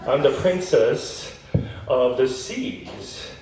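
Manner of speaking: mock-regal mermaid, slowed down; lightly voiced mermaid princess. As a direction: announce your title slowly and grandly. Mermaid introduces herself.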